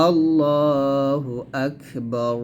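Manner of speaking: আল্লাহু আকবার drawn out very slowly; model recitation. Shown as a demonstration. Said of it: "এরকম". (ধীরে আল্লাহু আকবার, বার একসাথে)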